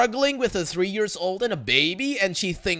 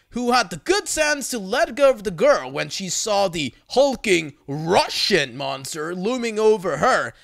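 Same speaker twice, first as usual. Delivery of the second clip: ordinary delivery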